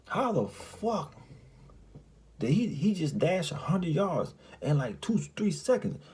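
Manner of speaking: high, slow, stretched disbelief; can't believe what you saw; amazed disbelief. Real, not acted. Amazed at sprinting old man.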